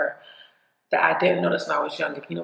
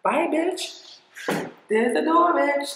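dismissive kiss-off, pitched much higher; sassy brush-off; 9 semitones higher, much slower than usual. Pretend. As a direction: wave them off with a high sassy sneer. Dismissing friends who walked away.

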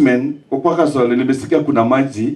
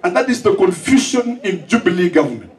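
high, impassioned rally speech; preaching at full force; 10 semitones higher than usual. Real, not acted. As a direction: thunder it at the crowd like a preacher. Fiery speech on teacher transfers.

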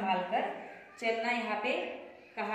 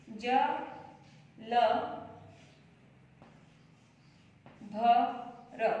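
अक्षर-अक्षर अलग बोलना; letter by letter; slower than usual. Shown as a demonstration. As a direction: sound out ज ल भ र one letter at a time with long pauses. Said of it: "जल भर".

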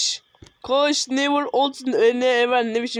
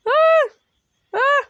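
startled scream; 5 dB louder than usual. Real, not acted. Sudden fright in the game.

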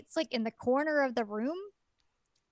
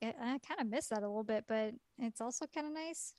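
sheepish aside; 9 dB quieter, faster than usual. Retold or imitated. Girl misses his weirdness.

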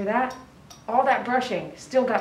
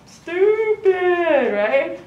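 drawn-out high, louder mock insult; playful teasing; 8 semitones higher, 5 dB louder, much slower than usual. Real, not acted. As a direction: tease the dog lovingly, stretching the word. Teasing the dog fondly.

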